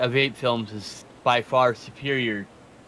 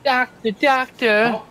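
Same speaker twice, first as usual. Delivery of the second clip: mock calling out; 9 semitones higher, 6 dB louder, much slower than usual. Mimicking characters calling out.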